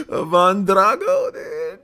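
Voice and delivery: high, slow, laughing squeal; cracking up. Real, not acted. Streamer still tickled by Drago, laughing hard. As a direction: stretch the words out through helpless laughter.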